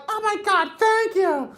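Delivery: very high, slow, gushing mock girl voice. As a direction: gush like you finally feel respected.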